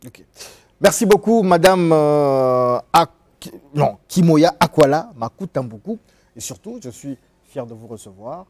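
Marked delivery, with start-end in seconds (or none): 0.8-6.0 grand announcer thanks. Real, not acted. Host's grand on-air thank-you.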